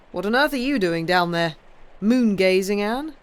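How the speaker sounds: a bluff man teasing his wife; hearty husband. A narrator's reading. Henry teases Anne in the garden, lightly hearty.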